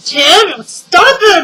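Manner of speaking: loud, high, shouting at husband; exasperated shout. Real, not acted. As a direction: yell off to the side, fed up. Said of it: "Sorry, that was my husband again".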